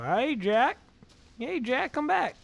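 high, loud call; calling after a dog. Acted.

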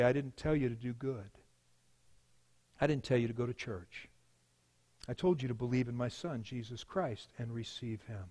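low, soft divine correction; voice of God. Acted. God corrects the sinner at judgment, low and quiet. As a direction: answer quietly and low, with authority. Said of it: "God would look at him and say".

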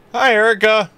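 high, loud, bright hello; excited greeting. Real, not acted. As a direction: call out happily to a friend. Greeting friend loudly.

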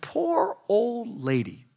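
high, slow mock pity; tender poor-thing lament. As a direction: draw it out with sympathy. Pitying the stranded old woman.

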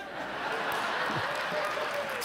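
quiet breathy laugh after the punchline. Real, not acted. Preacher laughing at his own story, soft laugh. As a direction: let a soft laugh slip out.

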